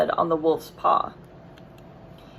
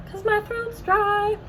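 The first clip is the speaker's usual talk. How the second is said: squeaky dry throat joke; 13 semitones higher, slower than usual. Real, not acted. Reader jokes about dry throat.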